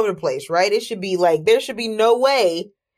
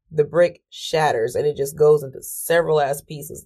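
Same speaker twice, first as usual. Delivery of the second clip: usual speaking voice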